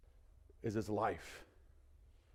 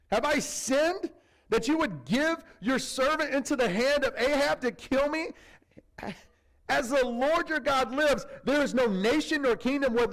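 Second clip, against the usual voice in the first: much higher, louder pleading; panicked Obadiah; 10 semitones higher, 4 dB louder than usual. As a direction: protest like a servant who fears for his life. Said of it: "Obadiah's a little tense".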